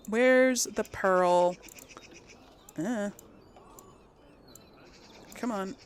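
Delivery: ordinary delivery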